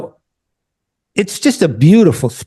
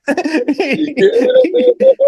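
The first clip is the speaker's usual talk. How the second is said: high laugh. Real, not acted. Laughing at own joke.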